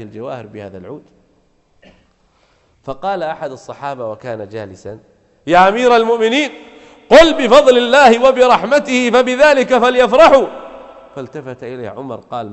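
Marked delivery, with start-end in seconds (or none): 5.5-10.5 loud, high, rousing proclamation; companion calling out. Acted. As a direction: call the verse out to the caliph at full voice. Companion calls out to the caliph.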